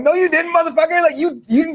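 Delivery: high-pitched incredulous scolding; mock indignant retort. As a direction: call him out in mock disbelief. Scoffing retort to the knocked-out fighter.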